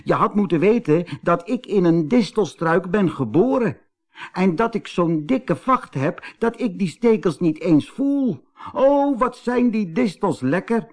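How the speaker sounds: gleeful boasting rabbit, high; Kees Konijn. A narrator's reading. Kees boasts to the fox, gleeful and high.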